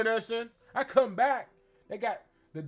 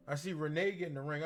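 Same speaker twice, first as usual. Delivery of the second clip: normal talking voice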